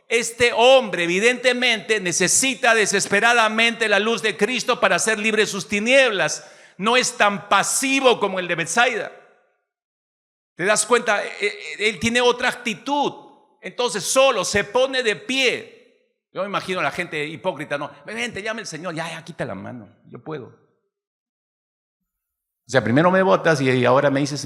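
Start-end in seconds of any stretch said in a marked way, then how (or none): 0.1-15.8 higher, louder impassioned sermon; fiery preaching. Acted. The preacher exhorts the congregation with rising, loud fervor. 18.1-20.5 mock crowd. Crowd pretending to help, blind man refuses. 22.7-24.5 louder, fast indignant complaint; indignant retort. Pretend. The blind man rebukes the fickle crowd, loud and indignant.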